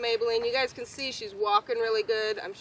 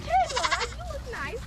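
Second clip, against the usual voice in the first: squeaky playful greeting, much higher; high silly voice; 14 semitones higher than usual. Retold or imitated. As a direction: chirp it up high like a cartoon. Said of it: "I was like".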